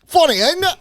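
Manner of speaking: very high, hammed-up Cockney; mock Cockney accent. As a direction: echo it in a silly fake Cockney accent. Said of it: "that's Cockney".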